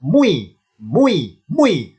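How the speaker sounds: MUI swooping up and down each time; laboured glide. Shown as a demonstration. Teacher shows why it becomes mu, exaggerated "mui". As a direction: repeat MUI with a big glide from U to I. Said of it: "Jadilah mu akhirnya".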